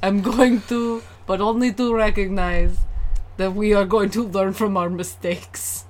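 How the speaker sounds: solemn cod-Russian pledge; badly faked Russian accent; mock Russian accent. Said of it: "the only accent I can do".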